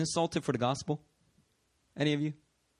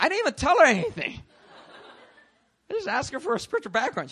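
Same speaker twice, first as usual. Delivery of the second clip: laughing in disbelief; 11 semitones higher, 4 dB louder, faster than usual. Real, not acted.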